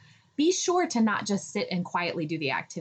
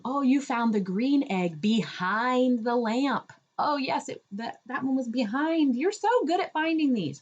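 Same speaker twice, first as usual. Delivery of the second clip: put-on higher, slow, delighted toddler talk; excited praise; 5 semitones higher than usual. Shown as a demonstration. Parent praising child on egg hunt, excited. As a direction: lean on behind, thrilled for the child. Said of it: "you're excited".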